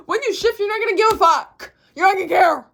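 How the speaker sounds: high, loud, laughing almost crying; overflowing giddy shout; overexcited. Real, not acted. Overexcited shout to viewers about shifting. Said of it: "I got a little overstimulated there".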